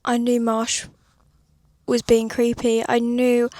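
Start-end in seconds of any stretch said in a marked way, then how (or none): none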